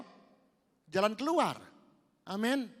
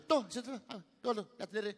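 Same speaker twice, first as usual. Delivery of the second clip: dismissive seller; 7 semitones higher than usual. Retold or imitated. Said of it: "misalkan ada juga".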